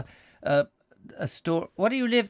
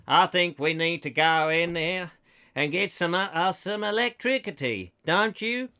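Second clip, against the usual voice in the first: put-on American that wobbles towards Australian; mock American accent; 2 semitones higher than usual. American driver needing electricity, mock accent slightly Australian. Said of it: "slightly absorbed".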